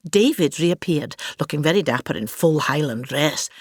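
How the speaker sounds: ordinary talk, nothing marked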